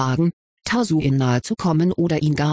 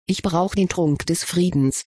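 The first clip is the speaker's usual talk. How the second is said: usual speaking voice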